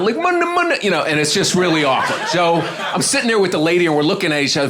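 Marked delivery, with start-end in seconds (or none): 0.2-0.8 high, wordless mumbo-jumbo noises; imitated bathroom noises. Comic mimicking sounds from the bathroom, imitated noises. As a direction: imitate odd sounds from behind a door. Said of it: "all these sounds coming out".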